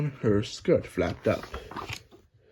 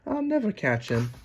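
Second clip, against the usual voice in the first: puffing; 7 semitones higher, 4 dB quieter than usual. Acted. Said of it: "puffed Alice".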